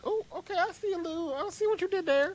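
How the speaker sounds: high, squeaky mock admiration; mock impressed voice. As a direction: squeal it like a delighted fan. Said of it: "for somebody to be like".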